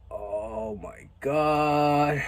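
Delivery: very drawn-out, despairing exclamation; slow-motion embarrassed dread; mortified groaning. Retold or imitated. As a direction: stretch every word out in dismay. Retells his mortified reaction.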